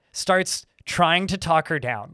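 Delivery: ordinary delivery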